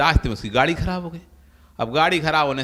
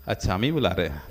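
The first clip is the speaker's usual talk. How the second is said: mock innocent; 10 semitones lower, 6 dB quieter, faster than usual. Feigned surprise at being called.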